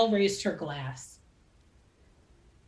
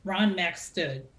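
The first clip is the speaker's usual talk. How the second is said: ordinary delivery, nothing marked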